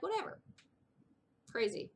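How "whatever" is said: high rising whatever; airy, high whatever; mock dismissive. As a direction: toss it off dismissively. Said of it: "So I'm like".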